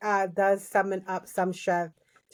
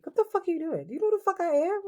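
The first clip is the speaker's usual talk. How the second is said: higher, mock outraged; badass Piper; 11 semitones higher, much faster than usual. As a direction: play it indignant and tough.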